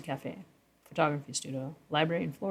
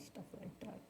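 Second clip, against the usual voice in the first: breathy whisper, dropping to almost nothing; whispered aside; 5 semitones higher, 20 dB quieter than usual. Real, not acted. Musing aloud about the saloon, whispered aside. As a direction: whisper it like a naughty secret.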